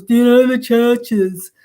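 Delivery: slow, drawn-out odd caller voice; lightly mocking the caller's strange voice; funny caller voice. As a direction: mimic the weird phone caller, slowed down. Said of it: "he had this real funny voice".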